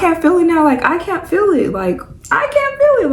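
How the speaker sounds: climbing to a very high squeal of disbelief; excited disbelief. Retold or imitated. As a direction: build up the amazed giggly wonder. Retelling numbness joy.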